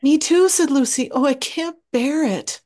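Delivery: high, loud and distressed; a small girl who can't bear it. Acted. Lucy cries that she can't bear the pulling, high and distressed.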